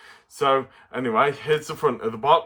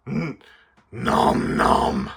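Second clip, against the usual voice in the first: munching creature voice; much slower than usual. Acted. Puppet Stripe answers.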